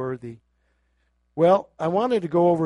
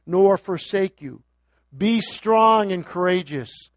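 preacher's raised voice; preaching; 5 semitones higher, 8 dB louder than usual. Real, not acted. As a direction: declare it over the people. Preaching, proclaiming scripture.

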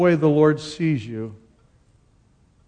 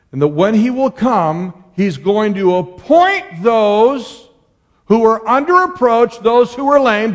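full-force preaching; 5 semitones higher, 5 dB louder than usual. Acted.

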